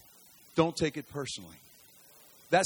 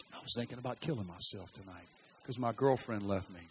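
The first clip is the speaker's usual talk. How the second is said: quiet retold confession; 6 semitones lower, 7 dB quieter than usual. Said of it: "He says".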